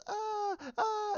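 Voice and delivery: high mock sobbing whine; imitated crying wail. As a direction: fake a child's high crying sound. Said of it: "I can't stop crying".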